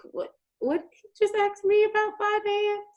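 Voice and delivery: high, baffled question; confused disbelief. Retold or imitated. Reacting to the finance question, high and baffled. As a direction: sound lost and baffled. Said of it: "I was just like".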